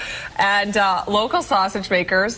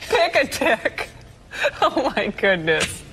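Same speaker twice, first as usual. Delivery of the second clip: slow, giggling through words; anchor stifling giggles; 4 semitones higher than usual. Real, not acted. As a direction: try to hold back laughter. Anchor repeats the blunder, laughing.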